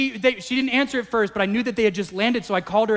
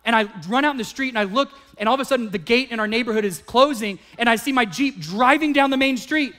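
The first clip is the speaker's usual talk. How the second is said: ordinary delivery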